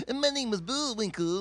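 high goofy cartoon moose; Bullwinkle voice. Comic imagines rapping Bullwinkle at club, cartoon voice. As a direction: belt the moose line. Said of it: "cartoon impressions".

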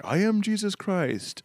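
imitating a child's grand proclamation, high and slow; Jesus Christ. Dad voicing costumed son announcing himself as Jesus, grandly.

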